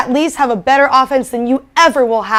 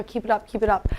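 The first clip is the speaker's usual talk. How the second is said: much softer, low taunting keep it up; teasing murmur; 4 semitones lower, 11 dB quieter, faster than usual. Real, not acted. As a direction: needle him under your breath. Teasing rival quietly.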